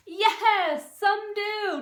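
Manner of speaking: high, loud surprised yes; spring the surprise on them; a high bright reveal for children; excited yes. Shown as a demonstration. Surprising answer.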